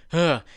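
disgusted ugh. Real, not acted. Disgusted aside while reading.

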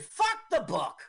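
high-pitched mock director's outburst; dismissive outburst. Director scrapping the book, high dismissive outburst. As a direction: blurt it out high, throwing it away.

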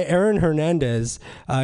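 ordinary delivery

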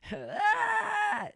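high, loud laughing shriek; real cackling shriek of laughter. Real, not acted. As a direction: burst into a screaming laugh. Laughing hard.

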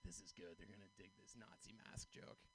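hushed, voiceless self-talk; acted private whisper, very soft; excited whisper to himself. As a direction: whisper it like a secret plan. Acts out his private scheming thought.

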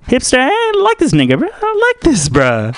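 very high, squealed bro voice; hammed-up high hype man; approving bro. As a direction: hype him up in a squeaky pitch.

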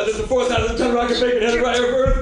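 loud, high action-movie bark; hammed-up blockbuster hero. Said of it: "starring Bruce Willis and Ben Affleck".

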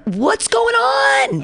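drawn-out, high-pitched cry of disbelief; exasperated outcry. Real, not acted. Host despairing at what's happening in the country, exasperated cry. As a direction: wail it slowly, voice leaping up. Exasperated end of her rant.

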